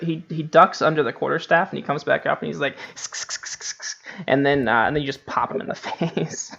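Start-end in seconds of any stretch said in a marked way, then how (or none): 2.9-4.0 unvoiced skittering sk sounds; kobold hissing. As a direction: hiss it out like a little kobold.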